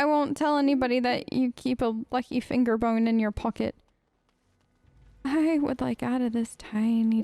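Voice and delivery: ordinary talk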